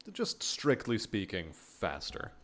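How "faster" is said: "faster" breathed out in a soft whisper. Pretend. Streamer muttering to himself about healing, whispered "faster". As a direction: drop to a whisper on "faster", as an aside. Repeats word under breath.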